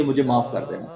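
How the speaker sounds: a touch of retold weeping, voice dropping away; tearful plea. Mother-in-law weeping daily at the grave, soft tearful plea. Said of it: "روز روتی ہے اور روز کہتی ہے".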